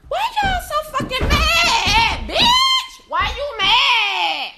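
high drawn-out yell, breaking up on bitch; hammed-up outrage at cashiers with attitude; mock exasperated yell. Yelling at rude Popeyes cashiers, a mock outraged shout.

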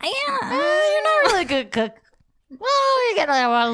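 high squeaky voice. Pretend. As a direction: squeak it out like a silly computer. Pretend computer answering.

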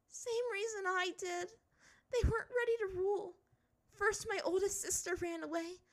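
high, crying confession, building to breakdown; tearful. A narrator's reading. Princess confessing to friends that she couldn't handle it, tearful. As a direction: pour it out through tears, voice high. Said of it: "Hot tears were streaming down her face".

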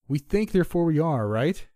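loud, high-pitched shout. A narrator's reading. Narrator to his friend over the winds, shouting. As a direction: shout the argument over the storm. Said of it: "I shouted".

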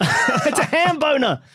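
high, slow, laughing exclamation; excited blurt. Real, not acted. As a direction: blurt the answer through laughter. Blurts out answer amid laughter.

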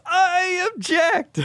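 high, slow, drawn-out shout; hammed-up wedding-crasher cry; dramatic yell. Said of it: "he just yells".

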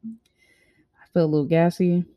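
talking through the hiccups; hiccuping between words. Real, not acted. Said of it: "I got the hiccups".

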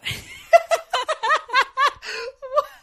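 loud, high wailing laugh; long, squealing belly laugh; helpless laughter. Real, not acted. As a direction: crack up completely. Laughs at her own confession.

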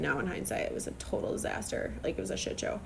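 hushed, confiding whisper; whispered aside. Real, not acted. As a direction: let it slip out under your breath. Confessional aside about bad show.

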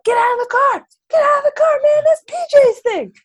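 high, loud frantic shouting; mock panicked yell. A podcaster voices friends yelling at Skinny to leave the stolen car, high and loud. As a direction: yell at him to get out now, voice cracking high.

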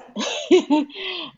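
high giggle at herself; laugh. Real, not acted. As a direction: laugh at your own joke. Laughing about pandemic clothes.

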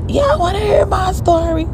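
high strained call to the crowd; shouting to the crowd, voice cracking; mock crowd shout. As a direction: yell it up high like a hoarse rapper begging the room. Rapper with lost voice calling crowd.

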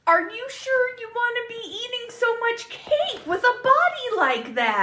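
high-pitched mocking imitation; Bob. Quoting the dad's remark about Winston's cake, high put-on voice. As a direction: put on a high voice for the quoted jab. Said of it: "And then Bob says".

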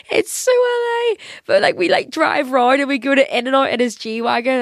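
high laughing squeal. Real, not acted. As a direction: laugh through the words. Laughing about friend's G-Wagon.

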